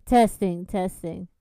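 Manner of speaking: loud, low sound-check voice. Shown as a demonstration. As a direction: project hard into the mic. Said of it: "Say it louder".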